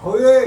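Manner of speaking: "होए" pushed hard from the throat; throaty emphatic he. Shown as a demonstration. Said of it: "जोर दे करके थोड़ा होए". ("होए" हलक से ज़ोर देकर)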